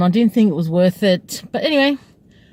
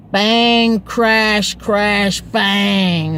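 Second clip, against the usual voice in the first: loud, drawn-out crash words; spaced, booming sound-effect words; imitated crashing; 3 dB louder, much slower than usual. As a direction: punch out each noise and stretch it. Imitates the crashes she heard.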